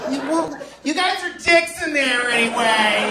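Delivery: shouting Mexican at the border. Acted. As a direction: yell from the border fence, hammed-up. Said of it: "this is a Mexican at the border".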